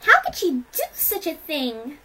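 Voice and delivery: high, loud shocked question; appalled outcry. A narrator's reading. Pinkie hearing what the mother did, appalled. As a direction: cry it out in dismay.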